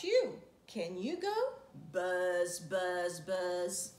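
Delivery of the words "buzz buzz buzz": buzzing bee. Shown as a demonstration. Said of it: "He can go like a bee".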